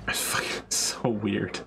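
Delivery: slow whispered aside. Real, not acted. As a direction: breathe it out slowly as an aside.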